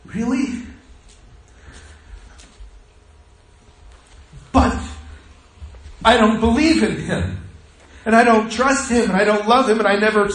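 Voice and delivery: high, pleading protest; imitated crying. Patient breaking down at God's message, imitated tearful protest. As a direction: retell a tearful confession, pitched high. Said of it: "he was crying so hard".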